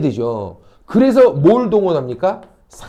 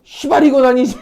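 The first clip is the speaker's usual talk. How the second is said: raised, indignant swearing; imitated angry outburst, higher and louder; mock outcry; 4 semitones higher, 5 dB louder than usual. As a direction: act out a bystander loudly objecting. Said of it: "괴성을 통해".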